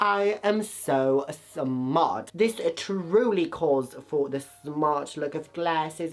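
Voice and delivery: lightly voiced posh British accent, slowed; a hint of smug British poshness; mock British accent. Vlogger putting on glasses, mock British accent. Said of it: "I have turned British now".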